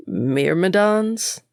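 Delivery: drawn out, puzzled question; slow puzzled repeat. Acted. Boy repeats the gardener's word, slow and puzzled. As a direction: sound out the strange word slowly.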